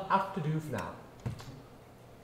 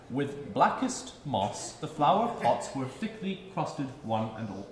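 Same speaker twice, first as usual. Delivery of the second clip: slow, each word carefully shaped; over-enunciate every word; over enunciating; 2 semitones lower, slower than usual. Shown as a demonstration. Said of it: "enunciate each word".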